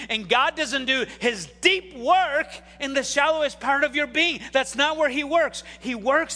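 loud, driving sermon delivery; full-force preaching. Acted. Pastor preaching to his church, loud and driving. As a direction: preach it hard to the congregation.

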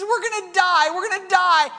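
high, loud mock-panicked wail; crying child. Son tells his father of the danger, crying in panic. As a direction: wail it like a terrified boy. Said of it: "and he's crying".

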